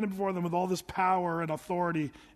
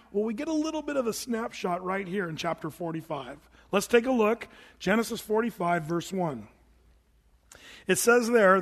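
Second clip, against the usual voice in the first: ordinary talk, nothing marked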